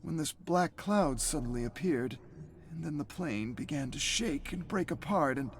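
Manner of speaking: a dazed pilot recalling the crash; a hint of a bewildered pilot. Pilot voiced in story.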